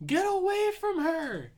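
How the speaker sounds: high, loud warning yell. Retold or imitated. Sarah warns Daniel about the killer, yelling. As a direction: yell it out in alarm like the character. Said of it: "Sarah yells".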